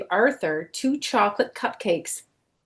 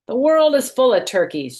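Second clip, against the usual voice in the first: joking; 7 semitones higher, 6 dB louder than usual. Acted. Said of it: "joked his mother".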